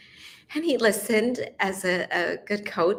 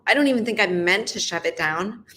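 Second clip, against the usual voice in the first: normal talking voice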